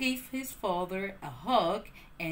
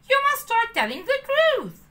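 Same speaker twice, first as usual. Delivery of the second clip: cricket voice; 12 semitones higher, 9 dB louder than usual. A narrator's reading. Jiminy urges the truth.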